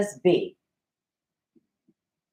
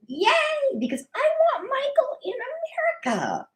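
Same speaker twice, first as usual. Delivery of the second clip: high squealing cheer, much higher; mock cheer. Host cheering for Michael's visa, squealing high. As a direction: squeal it like a thrilled fan. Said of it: "And I said".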